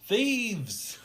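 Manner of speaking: high, loud mock cry of alarm; retold yell. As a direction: yell it out like a robbed man, imitating. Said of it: "And he yells".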